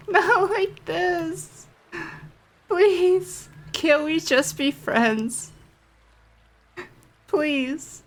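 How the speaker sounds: usual speaking voice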